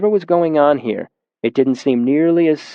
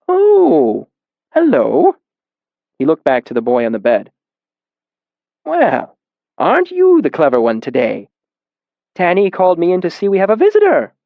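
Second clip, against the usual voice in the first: put-on higher, louder old man voice, a little quick at the end; surprised old man; 5 semitones higher, 4 dB louder than usual. A narrator's reading. Old caretaker noticing the narrator, higher and surprised. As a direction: greet the stranger warmly, surprised.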